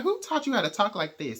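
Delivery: mock-scold the rude boy; a hint of an irritated elder; fed-up scolding. [name] fed up scolding.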